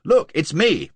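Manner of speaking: loud, high call to be recognised; shouting. A narrator's reading. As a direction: shout so she sees who it is. Clinging to coach, shouting.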